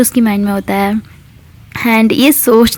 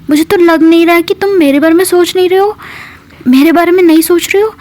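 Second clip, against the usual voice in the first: jealous hurt outburst; 7 semitones higher than usual. Retold or imitated. Jealous friend confronts Mitra, high and fast. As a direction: pour out the jealousy in a rush.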